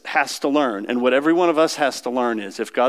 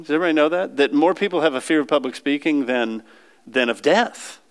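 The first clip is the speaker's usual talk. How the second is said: ordinary delivery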